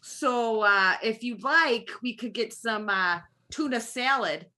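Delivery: put-on Wisconsin accent. Friend asked for a Wisconsin accent, answers in a light Wisconsin accent. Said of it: "can you give me a Wisconsin accent".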